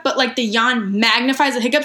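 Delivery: ordinary talk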